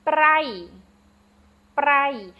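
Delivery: brai said loud and high with a falling tone; slow classroom demo of brai; Khmer word brai. Shown as a demonstration. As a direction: model brai clearly for repeating.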